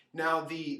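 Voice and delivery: ordinary talk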